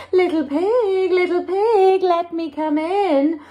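high singsong wolf call, slowing at the end. Acted. Wolf outside the house of straw, calling in singsong.

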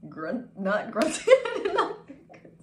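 loud and high, cracking up; laughing protest. Real, not acted. Streamer corrects herself about what she heard, laughing protest. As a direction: protest through rising laughter. Flustered over misheard word grunting.

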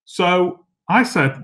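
normal talking voice

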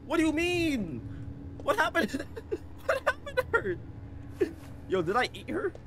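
high, laughing half-crying; laughing disbelief. Real, not acted. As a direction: laugh through the words.

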